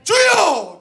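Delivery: high loud cry of 주여; crying to God in prayer. Retold or imitated. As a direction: call out to the Lord at full force.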